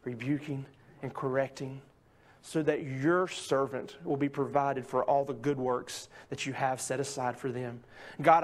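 soft, low, reverent prayer voice. Acted. Pastor praying over the congregation, soft and reverent. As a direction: pray quietly and earnestly before the sermon.

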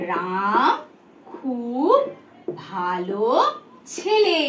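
word by word, long pauses between; dictation pace. Shown as a demonstration. Teacher writes the sentence for pupils, word by word. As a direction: say each word alone, as if writing it. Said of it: "ধরো আমি একটা বাক্য লিখছি".

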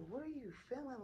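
mock-amazed question, pitch swooping high; retold astonishment, voice jumping up; imitating amazed staff. Staff retold marvelling at her handling pain, amazed.

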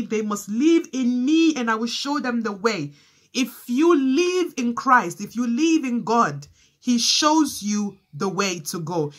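fervent preaching. Acted. Preaching builds at full force.

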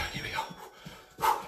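hushed, slow lead into the move; whisper. Acted. Instructor whispers the next move to her class. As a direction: breathe the cue out.

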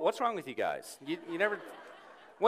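normal talking voice

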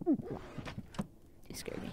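slow startled whisper. Real, not acted. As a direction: breathe it out scared.

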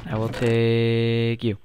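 slow, drawn-out, solemn; slow deliberate. Acted. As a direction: say it slowly, like a vow. Slow drawn second take.

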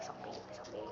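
unvoiced urgent whisper; hushed warning whisper. Real, not acted. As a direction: whisper so the zombies don't hear. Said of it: "เงียบๆเงียบๆ".

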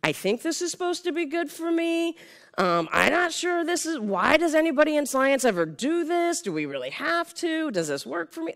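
high-pitched sceptical questioner; doubtful audience member. Acted. Speaker mimicking a person unsure about science improv, doubtful and high. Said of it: "presenting to the person who's like".

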